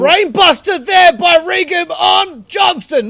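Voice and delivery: high, slow excited yell; excited shout. Real, not acted. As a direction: shout the move out. Commentator calls big move.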